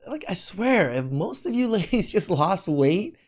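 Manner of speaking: ordinary talk, nothing marked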